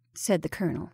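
ordinary delivery

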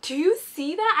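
real excited gasp at the result; amazed. Real, not acted. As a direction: let the amazement burst out. Amazed at makeup coming off.

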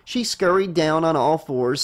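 ordinary delivery, nothing marked

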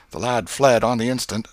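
ordinary delivery

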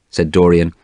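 plain reading voice

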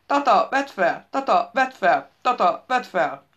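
loud, slow call that fades over the repeats; a phone yelling for grandpa, then dying down; shouting ringtone. Acted. The phone ringtone shouts for Tata to answer, loud and slow, fading. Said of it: "felrikkantott a mobiltelefon".

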